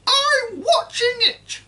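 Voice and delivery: put-on high, loud screeching old woman; cranky grandma yelling from the roof; shouting grandma. A narrator's reading. Grandma voiced throughout.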